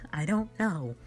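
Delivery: ordinary delivery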